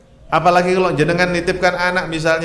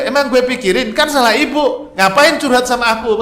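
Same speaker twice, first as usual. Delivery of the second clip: put-on cheeky child; 6 semitones higher than usual. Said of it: "Kalau anaknya pinter bilang begitu".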